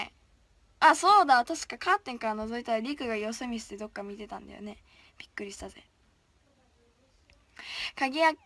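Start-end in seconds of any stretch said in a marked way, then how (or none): 5.2-5.8 low hushed aside; whisper. Real, not acted. Idol telling fans she got startled, whispering. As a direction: drop to a whisper.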